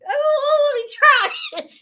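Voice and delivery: loud, high squeal through helpless laughter; crying with laughter, pitch soaring; laughing to tears. Real, not acted. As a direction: barely get the words out for laughing. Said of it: "laughing so hard that I'm fucking crying".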